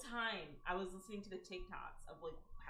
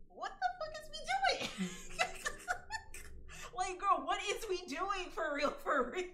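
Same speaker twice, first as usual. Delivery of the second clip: laughing; 11 semitones higher, 5 dB louder than usual. Real, not acted. Laughing at the absurd story.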